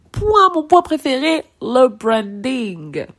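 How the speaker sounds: excited high, loud announcement stretching into a drawn-out final word; surexcitée. Real, not acted. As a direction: announce your favourite topic, overexcited. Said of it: "je suis trop excitée aujourd'hui". (annonce surexcitée, en étirant la fin)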